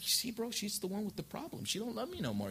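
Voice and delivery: soft, quick imitation of a defensive husband; husband blaming his wife, softly imitated; quiet mock husband. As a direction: mutter it like you're shifting blame.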